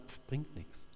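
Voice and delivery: dismissive scoff under the breath; quiet, unvoiced mocking dismissal. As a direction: brush it off with a soft, voiceless pff. Colleagues scoffing at the doctor.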